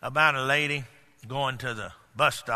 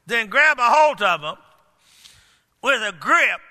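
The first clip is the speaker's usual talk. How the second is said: high, emphatic preacher's delivery; forceful preaching; 12 semitones higher, 9 dB louder than usual. Acted. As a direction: preach it hard, punching the key words.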